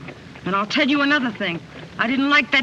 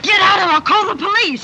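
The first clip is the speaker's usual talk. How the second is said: loud, raised-pitch threat; angry shout; 5 semitones higher, 6 dB louder than usual. Acted. Woman threatens suitor with police, shouting angrily. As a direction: order them out at full voice. Rejects declaration of love.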